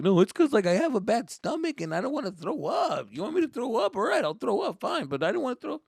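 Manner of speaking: put-on high, whiny kid justifying himself; making excuses. Scared kid excusing himself to cousins, whiny and defensive. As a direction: act defensive, pitch climbing. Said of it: "making excuses like".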